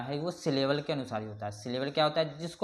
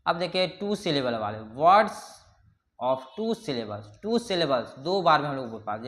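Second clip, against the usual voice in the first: ordinary delivery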